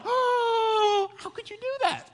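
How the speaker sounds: very high, wailing horror; mock freak-out. Sailors learn Jonah is running from God, high-pitched mock freak-out. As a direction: squeal it in hammed-up dismay. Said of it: "they're freaking out".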